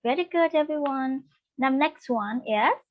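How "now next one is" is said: ordinary talk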